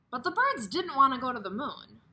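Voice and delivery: a hint of a chirpy bird. Quoted bird reply in story.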